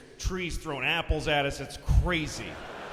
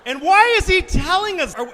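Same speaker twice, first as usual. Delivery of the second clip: ordinary talk, nothing marked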